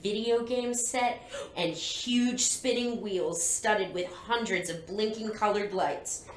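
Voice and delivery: story narration. A narrator's reading. Reads the book aloud.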